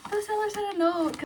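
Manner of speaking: high, thrilled squeal; giddy, high-pitched delight; delighted surprise. Real, not acted. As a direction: squeal over an unexpected note. Delighted to find seller's note.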